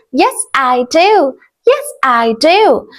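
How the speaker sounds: ordinary talk, nothing marked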